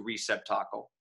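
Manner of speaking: "receptacle" stressed as REE-sep-TAH-cle; verse-guided. Shown as a demonstration. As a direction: give "receptacle" strong first and third syllables. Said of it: "the verse guided him to".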